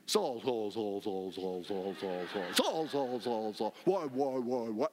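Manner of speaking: very low mock echo, fading as it repeats; deep reverberating voice. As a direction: imitate God's booming reverb by repeating each word. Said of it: "like, deep with a lot of, with a lot of reverb".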